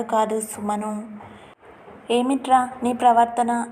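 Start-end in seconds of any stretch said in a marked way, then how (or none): none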